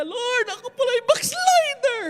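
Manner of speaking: loud, high wail, drawn out; tearful confession. Acted. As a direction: cry out to the Lord as a weeping mother. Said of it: "umiyak yung mama ko". (umiiyak na pangungumpisal ng ina)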